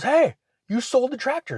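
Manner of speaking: high-pitched indignant complaint; mock outraged challenge; acted protest. Acted confrontation over sold tractor.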